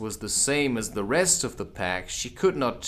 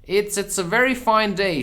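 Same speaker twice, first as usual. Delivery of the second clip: higher, louder halting voice; timid voice; 9 semitones higher, 5 dB louder than usual. Acted. A timid voice at Alice's side ventures a remark. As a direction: stammer it nervously. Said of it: "said a timid voice".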